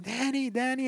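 high, frantic mock call for her son; imitating panicked Wendy. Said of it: "it really stressed me out how she runs".

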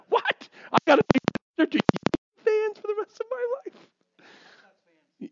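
very high, rushed mock shriek; outraged disbelief. Preacher told to minister to Yankee fans, horrified. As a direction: squeal it in comic horror.

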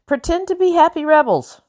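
high, strained order behind a smile; through clenched teeth. A narrator's reading. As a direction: command it through gritted teeth. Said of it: "commanded through clenched teeth".

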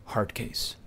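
breathy whispered aside, no voice. Real, not acted. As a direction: drop to a conspiratorial whisper. Aside about a hopeless student.

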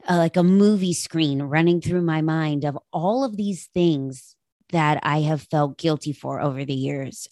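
ordinary talk

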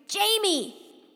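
loud, high-pitched drawn-out shout; shouted call. A narrator's reading. Boy searching for the newspaper girl, shouted call. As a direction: shout the name across a yard.

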